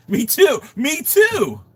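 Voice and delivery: squeaky high-pitched echo; high squeal. Real, not acted.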